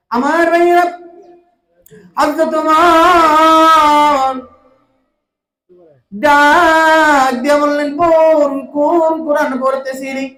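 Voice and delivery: high, loud chant with very long vowels; full-force melodic preaching. Acted. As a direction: cry out the story in soaring waz melody. (উঁচু গলায় সুরেলা ওয়াজ)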